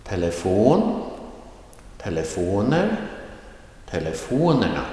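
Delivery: careful drill, "fon" lifted in each of "Telefon, telefoner, telefonerna"; rising tone, accent one. Shown as a demonstration. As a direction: pitch climbs late in "Telefon", on "fon". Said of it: "the rising tone, accent one, comes towards the end".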